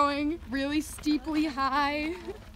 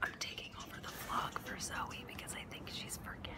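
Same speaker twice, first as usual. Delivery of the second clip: whispering; 16 dB quieter, much faster than usual. Real, not acted. Whispering taking over vlog.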